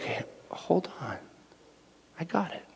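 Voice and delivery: soft whisper, hold on, I got it; God whispering calm to a worried believer; whispered reassurance. Retold or imitated. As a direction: hush a worried child. The Lord voiced reassuring.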